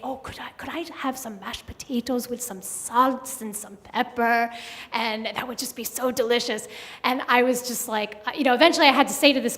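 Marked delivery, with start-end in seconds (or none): 0.0-6.7 put-on light German accent, a bit softer; [name]'s German accent. A man asking for mashed potatoes at the window, lightly German-accented. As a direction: slip in a hint of a polite German accent. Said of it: "I may have to throw some in".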